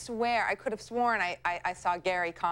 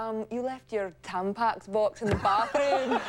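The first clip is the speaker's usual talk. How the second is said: bar owner on a prank call; 4 semitones higher than usual. Retold or imitated. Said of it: "I'd usually play, like, a bar owner".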